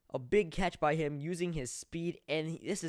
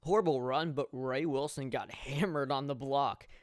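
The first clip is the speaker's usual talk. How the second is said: normal talking voice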